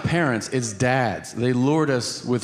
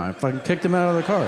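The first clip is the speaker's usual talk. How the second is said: ordinary talk, nothing marked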